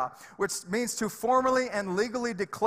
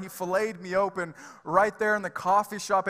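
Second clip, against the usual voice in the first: normal talking voice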